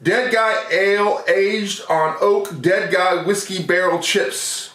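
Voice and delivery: ordinary talk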